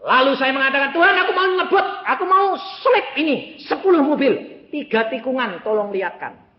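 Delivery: high, loud retold prayer with a laugh; loud preaching. Preacher retelling his prayer to speed past cars, loud and high. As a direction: pulpit storytelling at full pitch.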